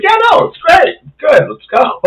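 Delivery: very high, forced it's-fine voice; fake cheerful. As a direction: cover the pain with false cheer. Pretending ankle is fine.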